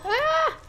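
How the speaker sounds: very high, loud shriek; panicked shriek. Real, not acted. Player cornered in the chase, shrieking in panic. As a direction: let out a sudden piercing scream.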